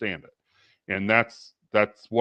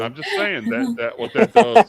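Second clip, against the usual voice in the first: high excited teasing; 13 semitones higher, 6 dB louder than usual. Real, not acted. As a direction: pitch jumps way up, teasing. Teasing about the rocking.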